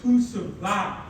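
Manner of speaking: normal talking voice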